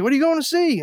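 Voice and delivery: calling across a crowd. Retold or imitated. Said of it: "He's like".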